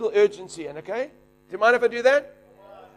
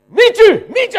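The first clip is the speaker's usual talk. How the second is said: eager plea; 12 semitones higher, 9 dB louder than usual. Retold or imitated. Neighbour patient asks for healing.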